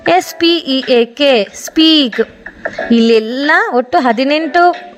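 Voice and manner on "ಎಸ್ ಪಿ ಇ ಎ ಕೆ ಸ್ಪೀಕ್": measured dictation pace for learners; unhurried classroom spelling read-out; slow spelling drill. Shown as a demonstration. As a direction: spell each letter slowly, then the word.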